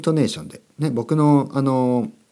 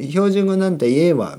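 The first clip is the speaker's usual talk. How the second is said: put-on higher, dismissive Kansai-accented quip; mock Kansai accent, pitched up; Osaka dialect; 7 semitones higher than usual. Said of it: "関西弁はいいんです".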